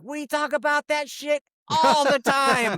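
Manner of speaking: high, slow, loud exclamation; acted delighted outburst. Haircutter recognizing the famous customer, delighted high shout. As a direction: stretch it out in a gleeful shout. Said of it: "she's dying laughing".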